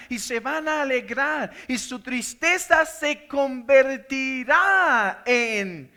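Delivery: exultant preacher building to a climax; fervent preaching. Acted. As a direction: lift the room to rejoicing.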